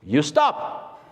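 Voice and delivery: lightly voiced photographer giving an order; a hint of a brusque photographer; Patrick de Marchelier imitation. Photographer orders two models to halt for reloading, mock command. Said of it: "and he goes".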